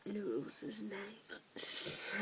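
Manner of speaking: slow breathy whisper. Real, not acted. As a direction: whisper it slowly and drowsily.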